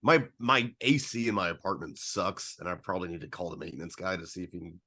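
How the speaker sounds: normal talking voice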